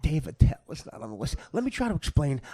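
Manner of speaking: ordinary talk, nothing marked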